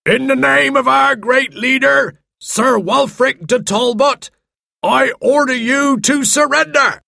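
herald's call from far below. A narrator's reading. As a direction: call up to a castle to demand surrender. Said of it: "heard a voice far below them".

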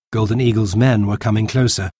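ordinary delivery

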